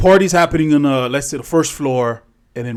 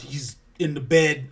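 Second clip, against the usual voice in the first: ordinary delivery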